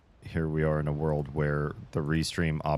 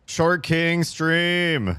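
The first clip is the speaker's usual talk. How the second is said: high, slow grand announcement; mock proclamation; 10 semitones higher, 5 dB louder, much slower than usual. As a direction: proclaim it like a show title. Jokey title for sinking stream.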